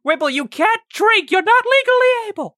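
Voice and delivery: high, loud exasperated telling-off; shouted scolding. Acted. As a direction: yell it at a misbehaving companion. Scolding Wibble for drinking.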